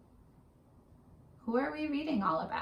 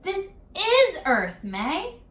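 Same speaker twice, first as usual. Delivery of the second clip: high, slow, loud motherly showing; a hint of a proud mother; lightly voiced mother; 9 semitones higher, 5 dB louder, slower than usual. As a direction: present the world to your child. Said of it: "her mother said".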